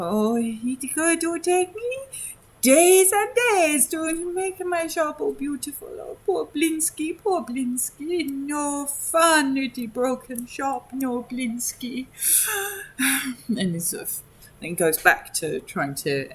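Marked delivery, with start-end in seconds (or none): 0.0-12.1 high, slow, mournful self-pity; sad Blinsky. Acted. Blinsky lamenting his broken shop to himself, high slow mournful voice. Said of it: "being a bit sad and looking at the state of his shop and go". 12.1-13.4 high whimpering sigh; sad sigh. Acted. Blinsky pitying his broken shop, high whimpering sigh. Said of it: "being a bit sad".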